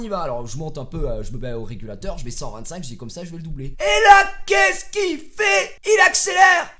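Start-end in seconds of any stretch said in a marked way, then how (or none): 3.8-6.7 high, loud stretched-out shout; yelling that the other guy sped up; outraged disbelief. Retold or imitated. Shocked retelling of the other driver.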